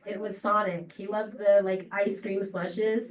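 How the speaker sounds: usual speaking voice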